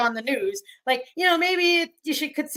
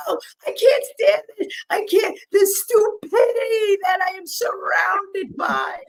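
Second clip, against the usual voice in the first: high, loud exasperated yell, slowing at the end; retold yelling; 10 semitones higher, 4 dB louder, slower than usual. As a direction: rant at the stupidity around you. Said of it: "stop yelling".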